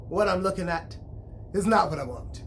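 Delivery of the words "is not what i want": ordinary delivery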